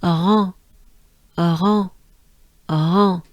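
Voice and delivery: rond, voyelle nasale; French nasal on. Shown as a demonstration. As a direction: drill "Rond" with a nasal vowel. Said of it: "keep your tongue down in your mouth".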